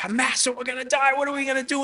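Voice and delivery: high-pitched, freaking-out plea; panicked disciples. Acted. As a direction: act out frightened disciples crying for help. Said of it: "They're freaking out".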